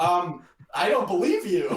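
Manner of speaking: high and loud, laughing through it; laughing disbelief. Retold or imitated. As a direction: doubt him while cracking up. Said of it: "And you're like".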